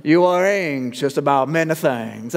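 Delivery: mock Southern Louisiana accent; Doctor Stevens. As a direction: imitate a Southern Louisiana pastor gently scolding. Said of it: "make him a Southern Louisianan Cajun".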